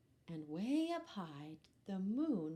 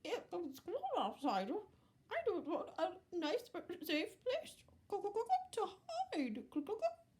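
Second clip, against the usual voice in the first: very high hen, clucking between words; fussy hen voice; 11 semitones higher than usual. Acted. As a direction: squeeze the words out like a fretting hen. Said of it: "said Hen".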